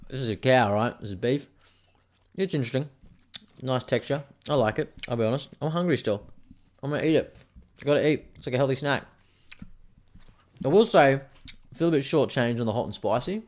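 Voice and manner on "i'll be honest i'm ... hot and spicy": ordinary delivery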